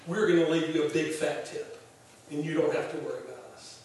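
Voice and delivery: calm her down softly; gentle reassurance. Retold or imitated. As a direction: friend speaking gently to a frantic waitress, reassuring. Ken gently calming stressed waitress.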